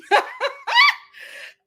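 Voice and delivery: high squealing giggle; giddy laugh. Retold or imitated. Still imitating Charity, giddy squealing giggle. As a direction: giggle like a flattered girl.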